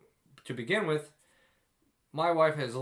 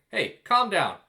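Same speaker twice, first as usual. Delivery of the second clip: 1 mock snappy calm down; 6 semitones higher, 7 dB louder, slower than usual. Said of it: "But don't ever say".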